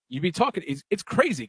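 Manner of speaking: ordinary talk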